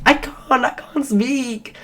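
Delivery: high-pitched acted pleading; high, drawn-out retold "can't"; helpless protest. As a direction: re-enact a helpless excuse, voice way up.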